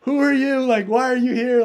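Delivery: high, loud retold police shout; mock SWAT team yelling. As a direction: bark the questions at intruders. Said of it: "literally yelling".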